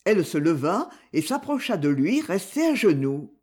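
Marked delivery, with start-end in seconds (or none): none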